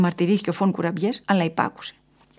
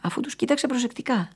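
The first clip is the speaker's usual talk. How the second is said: plain reading voice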